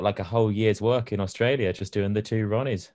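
ordinary talk, nothing marked

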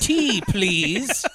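silly British voice; mock British accent. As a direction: ask like a cartoon Brit. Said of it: "that's what he sounds like".

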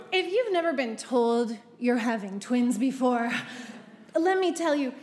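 usual speaking voice

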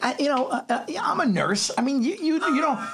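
high, hesitant mock aunt; half-mimicked nurse aunt; aunt stammering. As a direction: fumble it like the flustered aunt. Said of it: "She's like".

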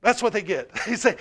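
ordinary delivery

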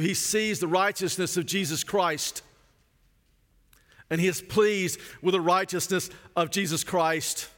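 ordinary talk, nothing marked

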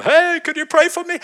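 high, pleading plea for prayer; high needy voice. Retold or imitated. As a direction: squeak it out like a timid man asking. Needy man asking for prayer.

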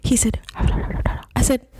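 hushed, half-whispered lead-in; imitating a whisper. Retelling the guy's whispered approach, hushed. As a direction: whisper it like the guy did. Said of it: "my guy was whispering".

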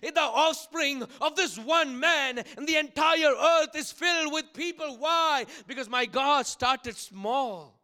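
loud, slow-stretched key words, sermon at full force; fervent preaching. Real, not acted. Preacher proclaiming God of small beginnings, fervent preaching. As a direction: proclaim it to the whole congregation.

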